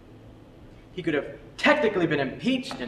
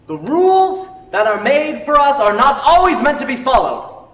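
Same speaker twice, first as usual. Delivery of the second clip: much louder, higher and slow; dramatic declaration; 8 semitones higher, 8 dB louder than usual. Acted. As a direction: proclaim it to the class like a creed. Teacher's climactic lecture line.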